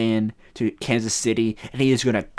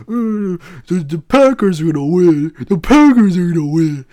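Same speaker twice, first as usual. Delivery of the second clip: higher, louder put-on voice of a Packers fan; mocking friend voice; 5 semitones higher, 8 dB louder than usual. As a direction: ham up a smug Packers fan. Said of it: "And be like".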